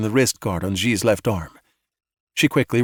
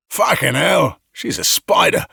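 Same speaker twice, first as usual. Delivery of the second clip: shout; 5 semitones higher, slower than usual. A narrator's reading. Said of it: "Dallas shouted".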